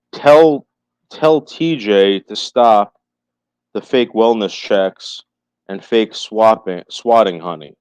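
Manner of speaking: slow emphatic read. Real, not acted. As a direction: lean on each word. Reading chat slowly, emphatic.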